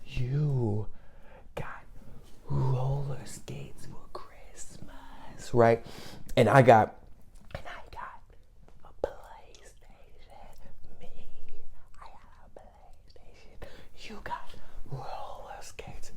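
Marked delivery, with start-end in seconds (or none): 0.0-5.5 slow, barely voiced mouthing; mouthed whisper. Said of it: "I said". 7.5-16.1 slow silent mouthing; mouthed whisper. Retold or imitated. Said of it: "I said".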